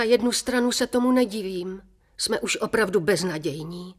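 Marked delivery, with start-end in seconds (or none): none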